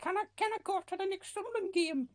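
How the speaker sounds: put-on very high squeaky sweet old lady; granny impression. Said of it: "trying to do a granny impression".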